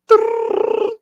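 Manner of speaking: high, loud rolled trill like a ringing phone. Pretend. Phone ringing before the call.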